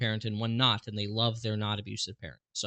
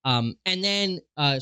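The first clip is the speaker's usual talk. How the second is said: normal talking voice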